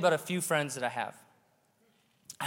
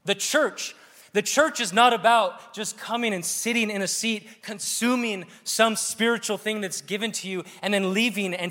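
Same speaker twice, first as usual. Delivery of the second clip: impassioned preaching; 5 semitones higher than usual. Real, not acted. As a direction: preach it with mounting force. Preacher builds to a climax.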